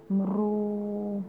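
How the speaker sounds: sleepy little cat purr; soft cat purr. A narrator's reading. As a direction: purr contentedly like a drowsy cat. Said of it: "Dało się słyszeć ciche kocie pomrukiwanie".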